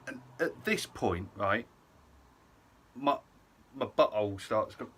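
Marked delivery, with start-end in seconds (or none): none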